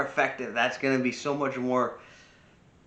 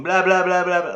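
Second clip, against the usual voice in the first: loud, higher-pitched run of blahs; mock noisy babble; 7 semitones higher, 10 dB louder than usual. Imitating a screaming child who won't listen, loud mock babble. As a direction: imitate a kid drowning you out.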